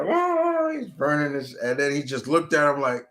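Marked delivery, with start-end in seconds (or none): 0.0-0.7 pained vampire cry. Retold or imitated. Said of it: "And he's like".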